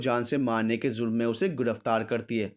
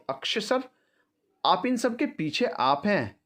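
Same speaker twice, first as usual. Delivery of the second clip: shocked; 9 semitones higher than usual. A narrator's reading. Nandini discovers Akshay is behind everything, shocked. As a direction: voice lifts high, stretched out in disbelief. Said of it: "Nandini चौंक जाती है".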